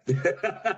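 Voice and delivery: a short high burst of laughter. Real, not acted. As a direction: laugh at the irony of it. Laughs after quoting the universe.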